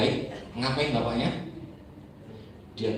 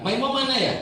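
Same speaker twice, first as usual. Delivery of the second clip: roll call; 12 semitones higher, 4 dB louder than usual. Retold or imitated.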